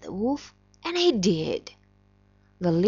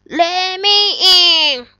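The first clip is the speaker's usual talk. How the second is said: wolf voice; 9 semitones higher, 11 dB louder, slower than usual. Acted. Wolf asks pigs to open.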